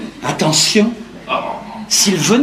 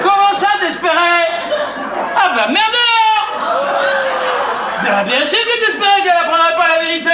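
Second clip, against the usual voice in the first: high-pitched indignant shouting; angry outburst; 6 semitones higher than usual. Acted. Man shouting at the brother who covered for him, angry outburst. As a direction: explode at your brother, voice pushed up high. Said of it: "modère ton langage et calme-toi".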